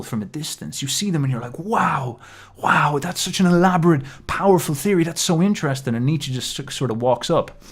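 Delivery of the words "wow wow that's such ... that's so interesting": higher mock wonder at a theory; mock awe. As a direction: play the dazzled admirer. Imitating an awed admirer.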